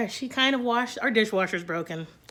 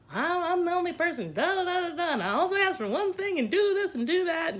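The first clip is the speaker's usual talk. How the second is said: mock rant; 9 semitones higher than usual. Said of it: "screaming and yelling ranting and raving".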